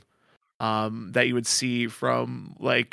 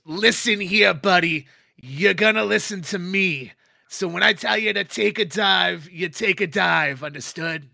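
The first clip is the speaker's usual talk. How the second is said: loud, high menacing order; threatening boss; 7 semitones higher, 7 dB louder than usual. Acted. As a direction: lean in and lay down the law.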